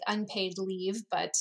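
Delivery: normal talking voice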